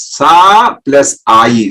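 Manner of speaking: slow, split into syllables Sa plus Ai; slow syllable-by-syllable explanation; syllables spelled out slowly. Shown as a demonstration. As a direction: lay out each syllable slowly for the listeners. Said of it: "Swami himself explained this".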